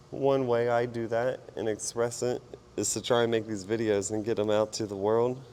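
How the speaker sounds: ordinary talk, nothing marked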